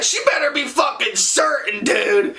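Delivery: high-pitched, outraged complaint; indignant. Retold or imitated. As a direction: protest an unfair accusation, pitch way up. Indignant at shaky identification.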